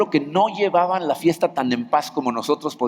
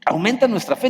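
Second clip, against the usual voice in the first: an urgent cry to be given more faith; higher, pressing appeal; higher exclaimed plea; 7 semitones higher than usual. Retold or imitated. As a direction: pitch "Aumenta nuestra fe" higher and stress "fe". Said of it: "los discípulos cuando oyen esas cosas dicen".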